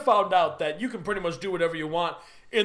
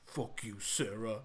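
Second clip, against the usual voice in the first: very soft, very low mock menace; low, cold curse; 12 semitones lower, 15 dB quieter than usual. Husband staring at his wife, hushed low mock contempt. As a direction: say it deep and barely voiced. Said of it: "stares at her and finally just goes".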